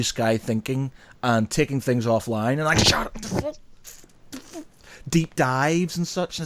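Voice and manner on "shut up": a high, breathy, whispered mock shout. Host imagining telling bosses to shut up, whispered mock shout. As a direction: snap back at the bosses in your head. Imagined retort to office bigwigs.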